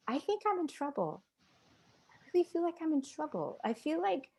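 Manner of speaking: put-on small, high teenage voice; retold confession, high and a little soft; like a child afraid of being in trouble; frightened young teen. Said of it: "It's like I was thirteen, fourteen again".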